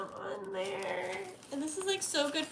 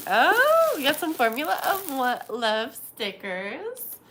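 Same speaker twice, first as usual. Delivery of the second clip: loud, delighted exclaim over stickers; excited; 11 dB louder, slower than usual. Real, not acted. Opening a friend's birthday package, loud and thrilled. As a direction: squeal it out, thrilled.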